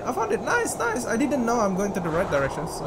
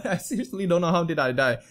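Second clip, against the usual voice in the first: ordinary talk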